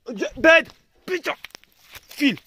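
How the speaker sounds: loud, high yell to shoo the dog; angry shout at the dog. Real, not acted. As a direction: bark the order to get away. Chasing dog off the food.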